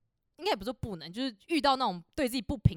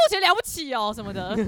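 mock sarcastic retort; 7 dB louder than usual. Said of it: "我同事就说".

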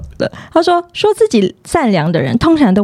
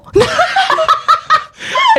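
laugh. Real, not acted. Laughs at own retort.

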